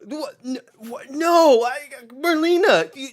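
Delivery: put-on a kid blindsided by the plot twist, near screaming; high, loud, betrayed disbelief; shocked kid outrage. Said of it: "I was like".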